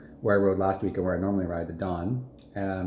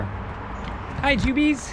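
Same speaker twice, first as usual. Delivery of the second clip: very high squeaky hello to the dog; squeaky dog greeting; 14 semitones higher, much slower than usual. Real, not acted.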